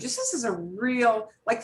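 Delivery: usual speaking voice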